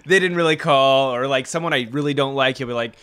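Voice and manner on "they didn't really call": mock gotcha. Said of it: "he's like".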